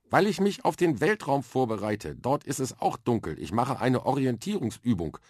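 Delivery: put-on a confident little girl explaining her plan; lively young girl. Girl with a bucket on her head explains space training to Arthur, eager and matter-of-fact.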